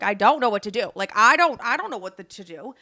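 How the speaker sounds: loud, flustered helplessness; retold panic. Unsure whom to call about the thief, loudly flustered. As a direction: blurt it out, stumbling and restarting.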